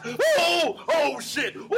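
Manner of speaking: high-pitched hammed-up panic; exaggerated fright. Imitating startled teenagers at the porch, exaggerated fright. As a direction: yelp it like mock-startled teens.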